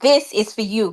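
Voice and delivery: ordinary talk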